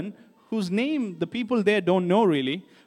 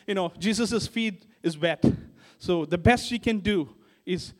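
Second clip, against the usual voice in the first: ordinary talk, nothing marked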